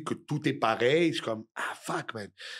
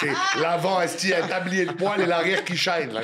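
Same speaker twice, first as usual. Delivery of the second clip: laughing; 6 semitones higher, 5 dB louder than usual. Real, not acted. Laughing through own joke.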